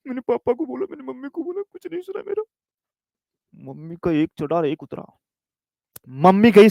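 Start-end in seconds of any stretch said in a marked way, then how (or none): 0.1-2.4 put-on high, soft, tearful child's whine; crying child. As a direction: whimper it like a little boy telling on someone. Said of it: "रोता-रोता गया".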